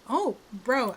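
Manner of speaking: normal talking voice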